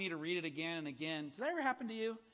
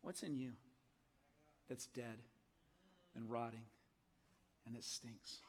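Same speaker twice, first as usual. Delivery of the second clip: gently and graciously; 7 semitones lower, 14 dB quieter than usual. Real, not acted. Pastor asking congregation gently, soft and low. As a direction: ask it tenderly, not accusing. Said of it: "I say that gently and graciously".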